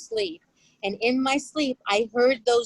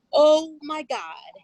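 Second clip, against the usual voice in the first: shocked; 6 semitones higher, 6 dB louder, much slower than usual. Retold or imitated. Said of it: "And he goes".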